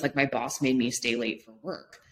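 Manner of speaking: usual speaking voice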